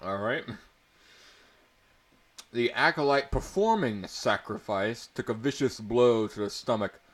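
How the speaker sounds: usual speaking voice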